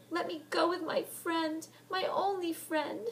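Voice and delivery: high, slow tearful plea; weeping piteously. Acted. Heather weeping to be let go to Helen. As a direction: beg through tears. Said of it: "she wept piteously".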